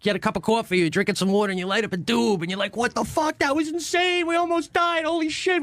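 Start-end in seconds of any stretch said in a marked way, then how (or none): 2.8-5.6 high-pitched, worked-up mock shock; retold disbelief. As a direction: relive the shock of nearly dying. Acts out shock after near death.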